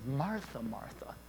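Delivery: soft and tender, trailing down; tender, fading call; compassionate. Retold or imitated. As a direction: gently call her name twice. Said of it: "he says it compassionately".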